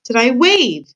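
loud clear repeated question; shouted. Acted. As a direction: raise the voice so she hears. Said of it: "shouted the P".

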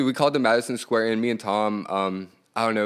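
ordinary delivery

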